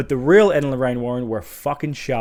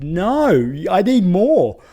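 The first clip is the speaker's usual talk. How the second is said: higher and louder protest, as if the film cut off; frustrated cry for more; 6 semitones higher, 6 dB louder, much slower than usual. Retold or imitated. Reviewer reliving the abrupt ending, a raised, higher-pitched protest. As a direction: mock-desperate plea for more. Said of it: "this is so short that I was like".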